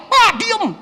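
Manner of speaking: high, loud cry of "diem"; a student shrieking at unseen spirits to be silent; retold scream. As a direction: yell it like a possessed child snapping. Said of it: "dia teriak".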